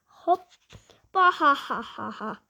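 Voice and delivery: acted belly laugh, stepping down in pitch; fake, hammed-up ha-ha cackle; mocking granny laughter. Grannies laugh at the player's airballed granny shot, mocking laughter. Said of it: "even the grannies laughed at me".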